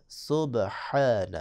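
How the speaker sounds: سبحان very slow, ha from the throat; slow tajweed demo of سبحان; throat h in subhan. Shown as a demonstration. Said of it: "ہا کو بھی آپ نے حلق سے ادا کرنا ہے".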